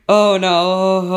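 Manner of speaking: drawn-out, loud "oh no"; loud dismay. Real, not acted. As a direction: cry out in nervous dismay. Panicking before the egg hatches.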